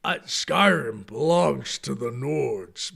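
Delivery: hearty Nord boast. Shown as a demonstration. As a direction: proclaim like a proud Nord. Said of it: "With Nords".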